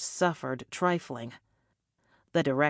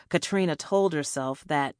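ordinary delivery, nothing marked

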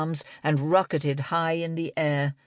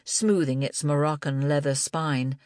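ordinary delivery, nothing marked

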